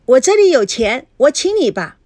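put-on high, eager child's voice; very happy. A narrator's reading. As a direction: chirp delightedly as a little girl. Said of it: "菲菲好高兴地说". (孩子高兴地抢着说)

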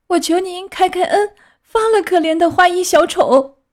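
put-on high-pitched begging puppet boy; pleading. A narrator's reading. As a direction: implore him to spare a friend. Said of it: "开始哀求他说". (尖声恳求开恩)

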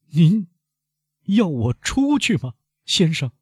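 high, pained, halting plea; sorrowful question. A narrator's reading. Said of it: "莫雷尔悲伤地问". (悲伤地高声问)

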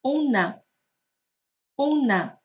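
"on a" run together, slow and careful; linked liaison. Shown as a demonstration. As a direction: carry the n of on into "a". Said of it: "我们连在一起读呢". (on a慢速连读示范)

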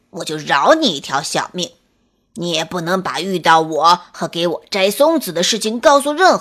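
low, loud wolf threats; bully the little one into silence; storybook wolf warning of dire consequences; lightly voiced menacing wolf.

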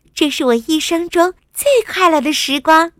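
high, loud, happy princess. A narrator's reading. Princess sitting with her father, happy. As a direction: answer brightly in a high little-girl voice. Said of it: "伊莎开心地说".

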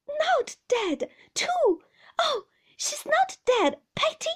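high, loud anguished cry; child wailing in dread; panicked child. A narrator's reading. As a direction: plead in terror, voice right up. Child fears mother is dead.

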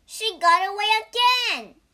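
high, loud shout of frustration; loud, exasperated yell; frustrated cry. A narrator's reading. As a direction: cry out as the quarry escapes. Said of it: "cried Wukong".

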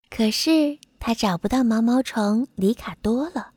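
usual speaking voice

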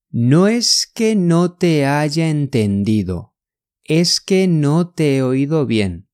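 measured teaching pace. Shown as a demonstration. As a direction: draw it out for learners. Slow model for learners.

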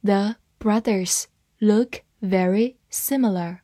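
slow, word-by-word model reading; spaced out slowly for learners to follow. Shown as a demonstration. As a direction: read it slowly with a gap after each word. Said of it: "慢慢来读".

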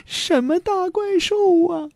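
put-on very high girl's voice; puzzled girl. A narrator's reading. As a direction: ask it high, in wonder. Daughter questions the boy.

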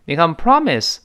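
ordinary talk

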